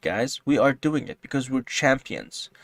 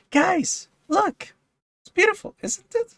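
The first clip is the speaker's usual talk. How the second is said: very high, swooning admiration; gushing delight; slower than usual. Real, not acted. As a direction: coo over it like a treasure. Swooning over the array.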